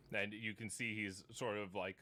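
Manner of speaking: ordinary talk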